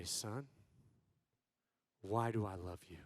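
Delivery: very low, soft question; low, restrained parental voice; hushed stern father. Retold or imitated. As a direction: ask your son quietly and gravely. Father sternly questions his son.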